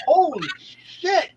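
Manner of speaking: slow, high drawn-out holy shit; shocked drawl. Retold or imitated. Telling friends about street sex, slow shocked holy shit. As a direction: stretch it out in mock disbelief.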